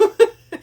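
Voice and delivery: loud high burst of laughter; showing off her laugh; big laugh. Retold or imitated. Demonstrates her unique laugh.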